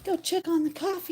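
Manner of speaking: lifted high and bright; high chirpy repeat; high sing-song. Real, not acted. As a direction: call it out high. Repeated aloud, much higher.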